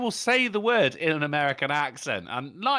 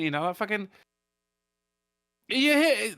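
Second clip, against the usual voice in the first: ordinary delivery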